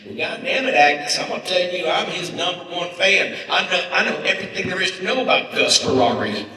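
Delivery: put-on high, loud Southern accent, indignant; hammed-up Southern lady laying down the law; pissed-off Southern lady. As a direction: play an outraged Southern superfan. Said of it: "she's pissed".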